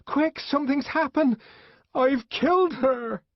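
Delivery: high and frantic, slowing at the end; a desperate confession blurted out; the savage telling the nurse he killed her; panicked. A narrator's reading. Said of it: "savage in panic".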